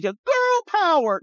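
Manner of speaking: high, slow mock girly chant; slow, high taunting parody of her slogan; mocking Nikki Haley. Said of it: "And she's like".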